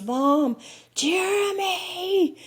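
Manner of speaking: high, long drawn-out call of a name; retold child's yell. Son calling out to mom from the woods, a drawn-out alarmed yell. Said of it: "our son Matthew yells".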